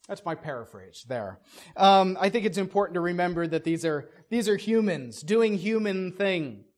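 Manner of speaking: ordinary talk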